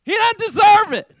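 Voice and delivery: high, loud protest; mock indignant shout. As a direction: object loudly, playing the self-righteous judge. Acting out refusing another person forgiveness.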